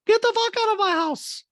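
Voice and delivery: very high, loud mock yell; Dorit. Housewife re-enacting what Rinna said, shrieked mimicry. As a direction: imitate an outburst at top pitch. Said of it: "And Dorit goes".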